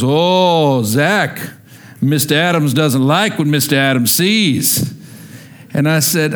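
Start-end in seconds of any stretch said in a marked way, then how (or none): 0.0-4.9 deep Southern teacher. Retold or imitated. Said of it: "really deep Southern voice".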